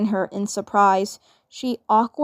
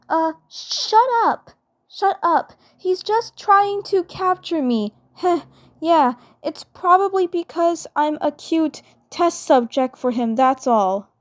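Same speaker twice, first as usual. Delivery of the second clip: put-on high, stammering girl voice caught out; a flustered twin sister protesting too much; flustered Mabel; 8 semitones higher than usual. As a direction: stumble over a panicked denial, pitched up.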